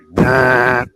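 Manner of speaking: loud mouth-made minigun burst; imitated roar of a minigun; minigun imitation. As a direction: blurt a harsh, loud gun-fire noise. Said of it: "it sounds just like it does on T V".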